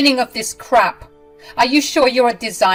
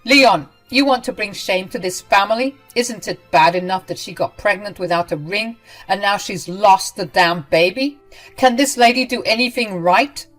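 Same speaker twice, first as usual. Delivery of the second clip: angry scolding; 3 semitones higher, 7 dB louder than usual. Acted. Ramona scolds son.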